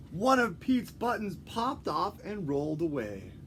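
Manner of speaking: ordinary delivery, nothing marked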